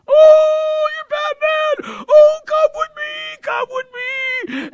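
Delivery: put-on shrieking, very high excited old man. Older prayer buddy thrilled to meet Batman, high excited shriek. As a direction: squeal it loudly, stretching the words. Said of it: "Ed's an older gentleman".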